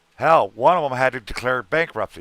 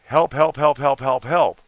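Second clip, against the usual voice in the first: higher, louder mock cry for help; mock pleading; 4 semitones higher, 5 dB louder than usual. Streamer parodying endless begging pleas, mock pleading. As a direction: parody a desperate beggar calling out. Mocking people begging online.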